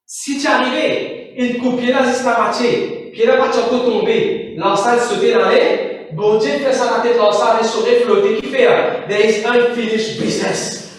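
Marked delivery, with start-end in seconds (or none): none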